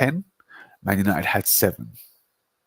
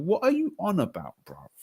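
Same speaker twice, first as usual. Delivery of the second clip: much higher, scoffing retort; incredulous retort; 8 semitones higher than usual. Real, not acted. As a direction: shoot down the claim in disbelief. Arguing football trophies.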